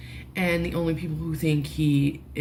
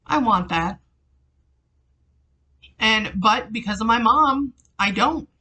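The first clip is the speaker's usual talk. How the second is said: upset, raised voice, higher than usual; getting emotional; 6 semitones higher, 4 dB louder than usual. Real, not acted. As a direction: pour it out on the edge of real emotion. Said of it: "I'm gonna get fucking emotional".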